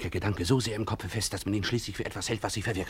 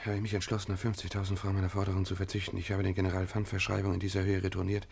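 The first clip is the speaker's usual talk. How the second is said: measured, quiet letter reading; reading a letter aloud; 4 semitones lower, 6 dB quieter than usual. Acted.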